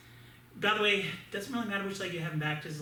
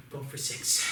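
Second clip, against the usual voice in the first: ordinary talk, nothing marked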